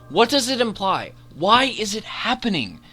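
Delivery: ordinary delivery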